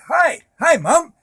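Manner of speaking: high, loud, bright-eyed hello; a son bursting in to greet his mom; eager greeting. Retold or imitated. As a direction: call out to her with hopeful excitement. Surprising his mother with a greeting.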